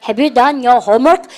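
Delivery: slow classroom repeat-after-me; very slow drill reading. Shown as a demonstration. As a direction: say it slowly so students can follow. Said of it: "시작".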